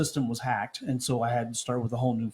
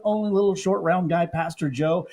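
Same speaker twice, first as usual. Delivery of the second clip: high, loud radio-host build-up; booming morning-show hype; hype announcer intro; 7 semitones higher, 5 dB louder than usual. Acted. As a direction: sell the hosts like a ring announcer.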